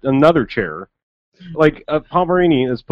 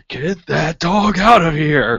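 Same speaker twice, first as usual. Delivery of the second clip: drawn-out, higher-pitched mock yell; mock angry holler; 5 semitones higher, 3 dB louder, much slower than usual. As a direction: holler it slowly like an exasperated guard. Acting guards angrily chasing dog away.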